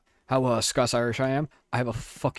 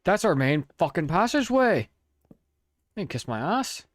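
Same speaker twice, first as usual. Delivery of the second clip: a put-on Irish brogue, a bit louder at first; mock Irish accent; 6 semitones higher, 3 dB louder than usual. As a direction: play a cranky Irishman telling a friend off. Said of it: "Why are you trying to sound British?".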